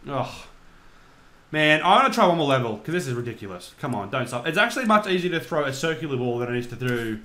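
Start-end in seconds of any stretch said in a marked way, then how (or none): none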